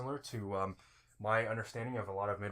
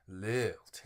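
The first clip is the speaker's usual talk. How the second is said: Southern lilt. Shown as a demonstration. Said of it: "so you want that lilt".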